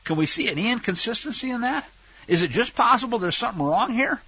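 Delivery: ordinary talk, nothing marked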